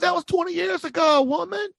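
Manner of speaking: hammed-up whiny husband; exasperated husband. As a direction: play the fed-up husband pleading his case. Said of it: "And you're going".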